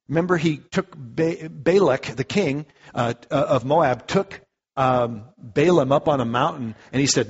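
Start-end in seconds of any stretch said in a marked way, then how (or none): none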